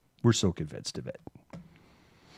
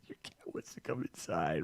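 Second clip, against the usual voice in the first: much softer, breathy whisper in disbelief; 3 semitones lower, 14 dB quieter than usual. Retold or imitated. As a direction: hush it like a stunned aside. Repeating kid's line in disbelief.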